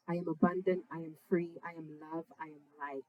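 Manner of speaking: quiet, fading affirmations, spoken not sung; softly spoken affirmations. Retold or imitated. Telling listeners how he reassured himself, softly murmured. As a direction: murmur them to yourself, trailing off. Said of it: "I had this song".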